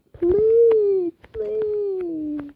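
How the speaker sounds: mock crying plea. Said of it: "she just yelled at me".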